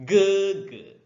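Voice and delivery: 哥哥 disebut gege, nada pertama tinggi dan rata; 哥哥 dengan nada tinggi yang ditahan; sebutan Mandarin. Shown as a demonstration. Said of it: "Abang sebutan dia ialah ini". (哥哥, gege, nada tinggi rata)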